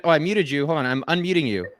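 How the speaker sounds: normal talking voice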